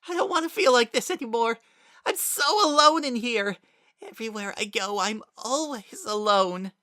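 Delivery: high, loud, fast sobbing; sobbing despair. A narrator's reading. Kara sobbing to Frisk that he is alone, high and loud. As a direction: let the loneliness pour out. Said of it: "he sobbed".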